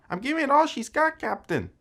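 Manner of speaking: high-pitched, badly faked Scottish engineer accent; bad Scotty accent. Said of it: "really bad Star Trek voices".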